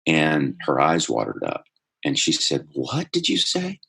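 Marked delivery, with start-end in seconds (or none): 2.7-3.8 slow, very high incredulous question; stunned disbelief. Retold or imitated. Teacher to boy who called himself stupid, stunned disbelief. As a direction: imitate a teacher who can't believe her ears.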